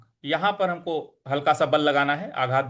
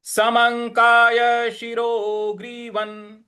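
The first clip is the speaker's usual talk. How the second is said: slow recitation, a bit higher; recitation demo; 6 semitones higher, much slower than usual. Shown as a demonstration. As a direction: recite it slowly for students. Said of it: "म के ऊपर जो अनुस्वार". (धीमा पाठ)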